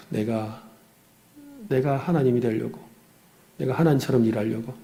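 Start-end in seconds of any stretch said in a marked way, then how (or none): none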